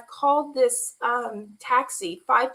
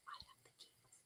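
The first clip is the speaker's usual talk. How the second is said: unvoiced secret whisper; 39 dB quieter than usual. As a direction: keep it barely audible, hiding. Hiding keys from brother.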